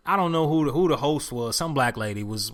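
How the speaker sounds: usual speaking voice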